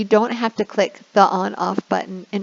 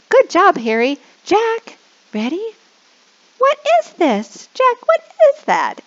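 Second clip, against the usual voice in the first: much higher, louder squeaky question; 13 semitones higher, 6 dB louder than usual. Shown as a demonstration. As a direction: squeak it playfully for kids. Squeaky prompting.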